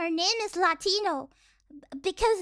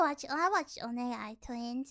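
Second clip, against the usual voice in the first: ordinary delivery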